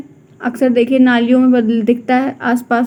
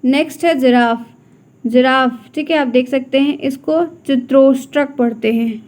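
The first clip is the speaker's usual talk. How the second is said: normal talking voice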